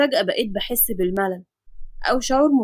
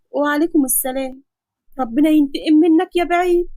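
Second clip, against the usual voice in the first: higher and louder, half-joking curse; mock-cursing girl; 4 semitones higher, 4 dB louder than usual. Nafisa cursing the doctor after he hangs up, higher louder mock anger. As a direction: fling a playful curse after him.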